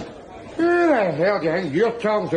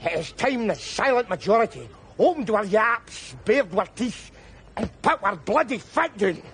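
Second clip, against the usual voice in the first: normal talking voice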